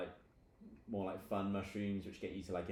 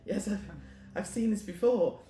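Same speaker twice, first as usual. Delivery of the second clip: high-pitched, excited discovery; thrilled yelp of recognition; excited creature; 13 semitones higher, 7 dB louder than usual. Acted. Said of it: "seems to be really excited".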